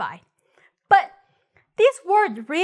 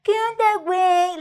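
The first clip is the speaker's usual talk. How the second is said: high-pitched mock Mexican accent; exaggerated Mexican accent; 5 semitones higher than usual. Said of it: "they exaggerate everything".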